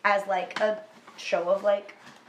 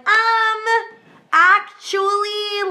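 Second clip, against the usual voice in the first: know-it-all drawl; 11 semitones higher, 9 dB louder, much slower than usual. Retold or imitated. Third person corrects Peter.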